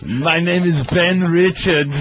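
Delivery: hammed-up Austrian action-star accent; awful German accent. Co-host repeating the movie name for laughs, awful German accent. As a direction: introduce yourself in a bad German accent. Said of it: "With that awful German accent".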